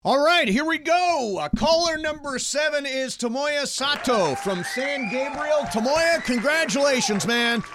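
high, excited game-show host; radio contest promo. Acted. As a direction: pump up the caller like a prize host. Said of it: "I love how excited you get when you say".

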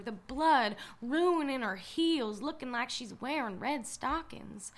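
ordinary delivery

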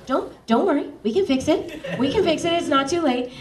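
high, hurried mock reassurance; retold anxious reassurance. Narrator calling the director after a mistake, retold frantic reassurance. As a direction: reenact scrambling to reassure him, pitched well up. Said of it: "And I was like".

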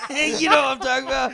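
loud laughing high question. Real, not acted. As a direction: shout it while cracking up. Laughing at a friend's mix-up.

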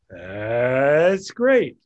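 big, booming cartoon tiger cheer; Tony the Tiger. Shown as a demonstration. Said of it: "Tigger, I did Tony the Tiger".

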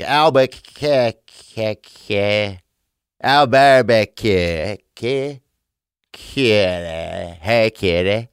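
Albuquerque stretched slow like a cat call; mock Albuquerque as calling a kitty; mangled Albuquerque. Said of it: "And I was like".

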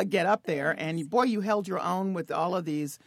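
ordinary talk, nothing marked

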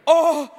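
high, loud "oh"; shocked cry. Retold or imitated. Puncher sees he hit a stranger, imitated shocked cry. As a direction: blurt out a dismayed cry.

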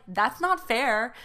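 put-on slow, drawn-out childish complaint; retold sulky protest. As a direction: complain like the wronged sibling. Retells sibling grievance.

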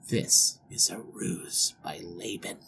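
low, slow near-whisper; hushed realization. Acted. Groom realizing the trick in bed, hushed. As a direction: think it aloud under your breath.

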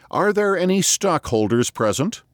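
very high, calling to the echoes; a high hollow hail into the distance; high distant call. A narrator's reading. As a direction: hands cupped, call it high and far away. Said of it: "making his voice high and distant as one calling to the echoes".

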